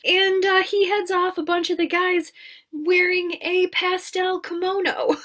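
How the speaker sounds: high amused disbelief; incredulous glee. Real, not acted. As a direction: marvel at the absurd outfit. Amused at kimono costume.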